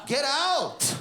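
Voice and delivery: rising to a high shout at the end; annoyed yell. Retold or imitated. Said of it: "You're like".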